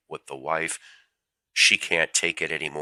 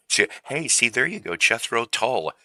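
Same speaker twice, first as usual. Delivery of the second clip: much higher, gleeful pun payoff; excited; 8 semitones higher than usual. Real, not acted. Pleased with his brick pun to viewers, excited. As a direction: crow over the joke.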